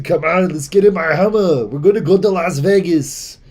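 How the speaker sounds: hammed-up Arnold inviting you to Vegas; Arnold impression. Arnold inviting a friend into his Hummer, hammed-up Austrian accent. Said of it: "that sounded like a Muppets character".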